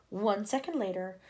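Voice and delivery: plain reading voice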